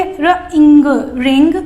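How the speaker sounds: "र, इंग" split apart and then joined into "रिंग"; sound blending. Shown as a demonstration. Teacher showing how kids read ring. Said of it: "तो कैसे पढ़ेंगे?".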